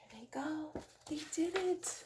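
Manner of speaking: quietly happy as they swim off; soft relieved aside. Pretend. Hushed aside after the rescue.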